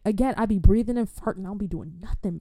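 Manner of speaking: ordinary delivery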